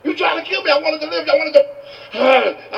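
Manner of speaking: very high-pitched incredulous outrage; hammed-up shrieking disbelief; going off again. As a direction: rant frantically at the man who dunked you. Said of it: "he goes off again".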